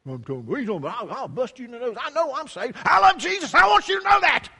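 hammed-up outrage rising to a shout; mock angry churchgoer. Preacher mimicking a churchgoer threatening a fight, building to a yell.

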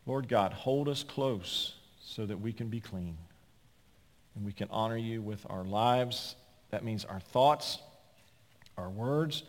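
deliberate phrases, swelling on stressed words; public prayer. Real, not acted. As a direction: lead the congregation in earnest prayer. Leads closing prayer.

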